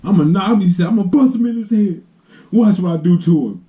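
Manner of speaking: put-on a kid crying but swearing to get even; tearful threat. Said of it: "he had little tears coming out of his eyes".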